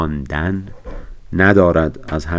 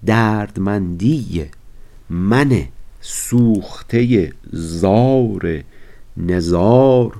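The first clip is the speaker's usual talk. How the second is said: slow, drawn-out reading of a Persian verse; slow classical poem recital; poetry recitation; much slower than usual. Acted. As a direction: savour each word of the verse slowly.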